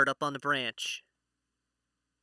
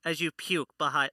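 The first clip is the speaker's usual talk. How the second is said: normal talking voice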